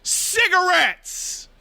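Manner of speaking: put-on high, slow, drawn-out taunt of a toddler testing limits; a toddler staring down his parents, teasing them with a naughty word; defiant toddler taunt. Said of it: "look you in the eye and go".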